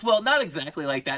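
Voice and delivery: high, giggling retelling of the coach's line; laughing through the words; laughing quote. Retold or imitated. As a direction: barely hold back laughter while quoting him. Retelling a funny putting lesson.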